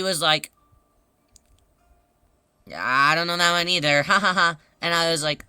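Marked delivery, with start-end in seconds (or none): none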